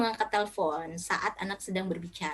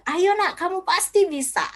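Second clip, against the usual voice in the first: high, louder encouragement; rally a child with confidence; motivating; 9 semitones higher, 5 dB louder than usual. Shown as a demonstration. Parent motivating their child, encouraging. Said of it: "Gunakan kata motivasi".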